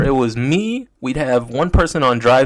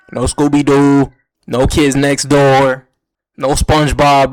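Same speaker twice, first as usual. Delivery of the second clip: ordinary delivery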